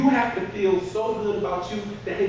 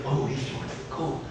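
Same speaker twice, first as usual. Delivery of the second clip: a soft, breathy muttered aside; half-whispered to himself; hushed aside; 2 semitones lower, 6 dB quieter than usual. Retold or imitated. Dad eyeing shoes at the store, softly muttered.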